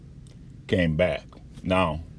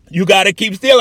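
raised, high-pitched command; retold sharp order; 10 semitones higher, 8 dB louder than usual. Doctor telling patient to keep still, retold as a loud order. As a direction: imitate a doctor loudly insisting.

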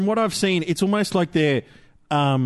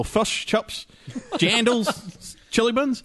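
mock Kiwi accent; 7 semitones higher, much slower than usual. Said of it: "It sounded like he was a New Zealand".

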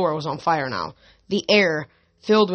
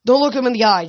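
warning; 6 semitones higher, 6 dB louder, faster than usual. Acted. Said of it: "Jason warned".

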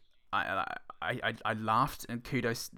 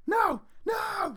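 much higher shrieking laugh protest. Real, not acted. Reacting to the Joker gag, laughing shriek.

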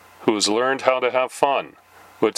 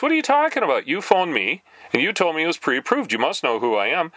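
higher, fake outrage at the caller; mock indignant; 6 semitones higher than usual. Challenging the telemarketer, in mock indignant outrage. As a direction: act wronged and demand answers.

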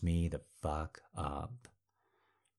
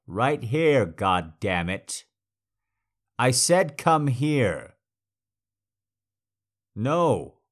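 louder and higher, slowing on the repeat; angry command; 4 semitones higher, 7 dB louder, slower than usual. Acted. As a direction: lose patience and slam the order down.

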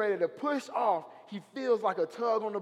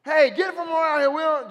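shouting townsfolk; 10 semitones higher, 10 dB louder than usual. Retold or imitated. Townsfolk voiced chasing Jesus off.

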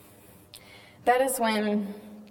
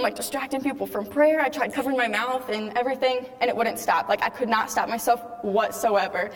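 ordinary talk, nothing marked

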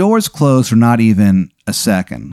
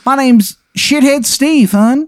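put-on terrible Maryland accent; 13 semitones higher than usual. Odd detective introduces himself to Andrew, bad Maryland accent. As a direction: introduce yourself in a dreadful high Maryland accent. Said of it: "his accent, again, like I said, is terrible".

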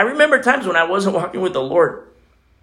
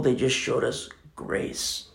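slow, breathless whisper of awe; 4 semitones lower, 11 dB quieter, much slower than usual. Real, not acted. Preacher telling how strangers showed him grace, slow whisper. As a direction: let it fall to a slow whisper. Awed memory of strangers' kindness.

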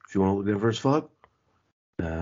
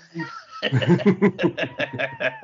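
high, bright burst of laughter; giggle; 11 semitones higher, 3 dB louder than usual. Real, not acted. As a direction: laugh at the running joke. Laughing at the Dynamite call.

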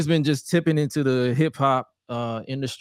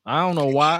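ordinary delivery

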